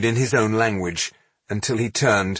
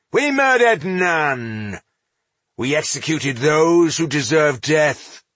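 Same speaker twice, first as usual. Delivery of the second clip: raised, emphatic denial; firm retort; 7 semitones higher, 3 dB louder than usual. A narrator's reading. Dwarf denies murder to the accusing elf, firm retort. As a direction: draw out the denial, then justify it. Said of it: "came Ginsgar's swift retort".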